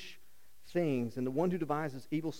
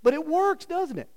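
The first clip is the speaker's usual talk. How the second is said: emphatic; 10 semitones higher, 6 dB louder than usual. Acted. Repeating punchline louder.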